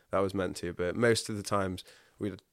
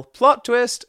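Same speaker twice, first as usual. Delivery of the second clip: loud, much higher yell of plot twist; 13 dB louder than usual. Retold or imitated. Yelling the affirmation when life goes wrong, loud. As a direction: shout it out like a cheer.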